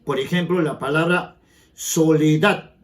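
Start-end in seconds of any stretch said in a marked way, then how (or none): 1.7-2.8 "soledad" slowly, landing on -dad; so-le-DAD, "soledad" drawn out for the class; stress on last syllable. Shown as a demonstration. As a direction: push the voice onto the end of "soledad". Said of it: "Ve el tono, el impulso de voz".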